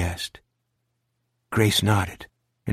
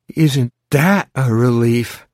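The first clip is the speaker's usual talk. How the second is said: slow, louder, theatrical sarcasm; dramatic sigh; 5 semitones higher, 8 dB louder, slower than usual. A narrator's reading. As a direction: overplay the relief like a bored kid. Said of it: "Sarah sighed dramatically and said".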